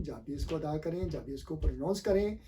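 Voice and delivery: ordinary talk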